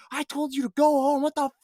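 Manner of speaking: imitated high, loud angry rant; freaking-out yell. Sergeant at the end scolding the officer, mock furious yell. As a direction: lose your cool completely, hammed up. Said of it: "He's, like, freaking out".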